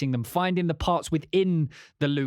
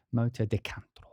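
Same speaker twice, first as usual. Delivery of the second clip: Spanish accent; 4 semitones lower, 4 dB quieter than usual. Shown as a demonstration. Naming the track with a put-on Spanish accent. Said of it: "the accent on it".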